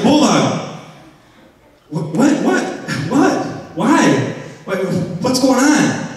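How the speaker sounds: high, stammering questions; flustered amazement. Retold or imitated. Questioning the talking cat, high and flustered. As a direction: trip over the words in shock. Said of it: "I was like".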